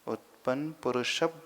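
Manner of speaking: उत्पन्न पुरुषप with a slight extra प before भ्र; लग्न पुरुषप: touch प before the cluster; extra p sound. Shown as a demonstration. Teacher shows the extra प heard before भ्रा. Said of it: "पवर्ग का अंतिम अक्ष प".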